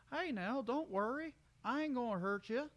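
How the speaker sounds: high, gentle folksy comfort; calm her down kindly; reassuring country stallion. Acted. Brayburn soothing the cringing mare, high and gentle.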